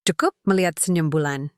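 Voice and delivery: ordinary delivery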